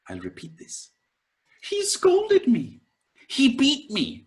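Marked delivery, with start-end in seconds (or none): 1.6-4.2 pitched high, indignant list of wrongs; aggrieved complaint. Retold or imitated. Teacher voices someone listing grievances, high and indignant. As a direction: whine each grievance like it still stings. Repeating the grudge quote.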